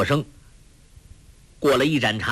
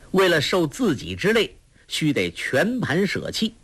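ordinary delivery

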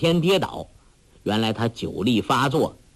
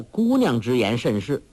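usual speaking voice

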